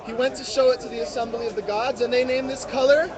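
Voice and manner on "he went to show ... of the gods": ordinary delivery, nothing marked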